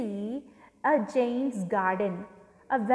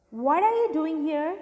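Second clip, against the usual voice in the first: mildly angry cry, pitched higher; gruff cry; 4 semitones higher, 4 dB louder than usual. A narrator's reading. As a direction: read a gruff challenge with restrained force. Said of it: "he cried in a very gruff voice".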